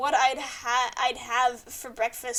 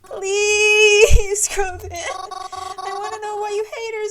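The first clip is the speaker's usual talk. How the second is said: loud, higher drawn-out plea; pleading shout; 6 semitones higher, 10 dB louder, much slower than usual. Acted. Streamer pleading with Groven: loud drawn plea. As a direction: stretch the plea out loudly.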